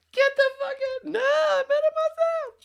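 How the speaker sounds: extremely high, loud comic squeal; Bronson Pinchot. Retold or imitated. Quoting Beverly Hills Cop, squealed character impression. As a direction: screech it as a camp movie character. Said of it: "Bronson Show and Eddie".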